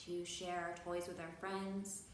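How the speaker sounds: usual speaking voice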